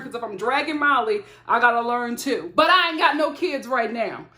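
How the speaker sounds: normal talking voice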